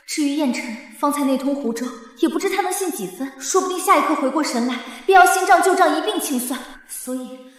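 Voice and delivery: soft, private scheming aside; hushed planning to oneself; inner monologue. Acted. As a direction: think it through under your breath.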